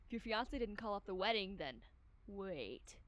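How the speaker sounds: ordinary delivery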